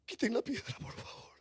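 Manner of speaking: whispered, broken plea; pleading whisper. Acted. Weeping Jesus begging them to move the stone, whispered plea. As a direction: barely breathe the request.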